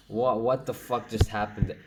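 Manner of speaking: ordinary delivery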